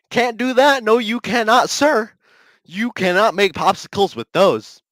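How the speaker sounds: high and loud, worked up; heated rant. Real, not acted.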